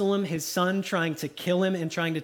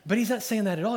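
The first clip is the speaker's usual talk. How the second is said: ordinary delivery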